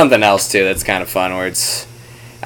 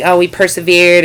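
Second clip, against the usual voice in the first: mock sappy; 9 semitones higher than usual. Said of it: "really sappy and, like".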